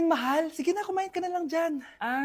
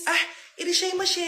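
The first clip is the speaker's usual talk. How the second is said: ordinary talk, nothing marked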